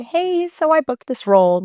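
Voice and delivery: high, tentative retold pitch; acted-out sheepish opener; sheepish announcement. Retells breaking news to director.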